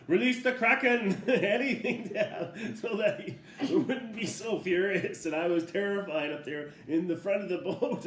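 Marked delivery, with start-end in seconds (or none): 0.1-5.2 laughing. Real, not acted. Laughing retelling of boat terror.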